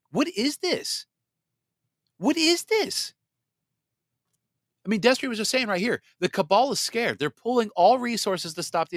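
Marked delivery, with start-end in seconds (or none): 0.1-3.1 high, incredulous what is this; mock disbelief. Host mimicking a baffled viewer, high incredulous. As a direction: act baffled at a crazy world. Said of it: "you're like".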